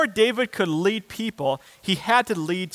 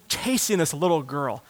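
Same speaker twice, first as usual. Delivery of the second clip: usual speaking voice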